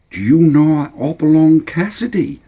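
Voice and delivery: put-on high, curious young boy; eager kid voice. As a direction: ask it wide-eyed. Boy asks.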